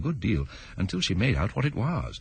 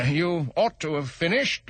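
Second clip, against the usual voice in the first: king voice; 9 semitones higher, slower than usual. Acted.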